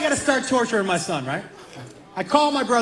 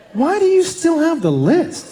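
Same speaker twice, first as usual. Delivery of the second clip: incredulous; 7 semitones higher, 3 dB louder, slower than usual. Retold or imitated. Incredulous question to brother.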